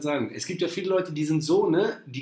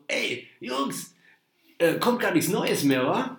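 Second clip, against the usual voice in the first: imitating Woody; 5 semitones higher, slower than usual. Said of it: "Der meinte so".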